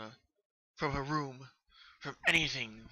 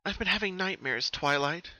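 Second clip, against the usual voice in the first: a hint of higher fearful confession; hesitant confession; 7 semitones higher than usual. Pinkie finally admitting her nightmares to Twilight, hesitant voice. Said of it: "Pinkie finally said".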